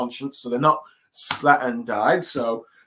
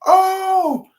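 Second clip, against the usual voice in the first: much higher, louder lovesick wail; Sanji crying out; 7 dB louder, much slower than usual. Retold or imitated. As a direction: sing her name like a smitten fool. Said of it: "you hear Sanji's".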